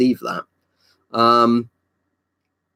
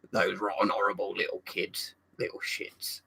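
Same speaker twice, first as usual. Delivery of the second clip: low, quiet mutter of disgust; hushed, sour character voice; imitated TV character; 3 semitones lower, 11 dB quieter than usual. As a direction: grumble it under your breath in character. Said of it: "I don't think he actually says that".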